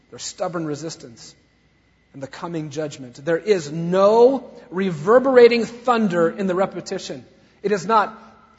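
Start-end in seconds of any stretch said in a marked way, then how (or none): none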